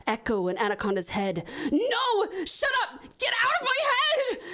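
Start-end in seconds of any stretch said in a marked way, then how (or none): 1.7-4.4 panicked scream. Acted. Anaconda screaming at voices in his head, high panicked yell. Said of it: "he says in a panic".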